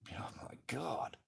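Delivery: soft unvoiced whisper. Real, not acted. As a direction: breathe it in disbelief. Whispered aside.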